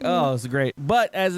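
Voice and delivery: ordinary talk, nothing marked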